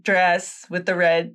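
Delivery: ordinary talk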